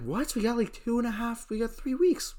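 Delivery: high, incredulous protest; rising shock, then fast; shocked disbelief. Retold or imitated. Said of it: "I'm like".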